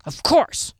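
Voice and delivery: brusque, indignant answer; gruff. A narrator's reading. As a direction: snap back gruffly. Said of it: "he answered gruffly".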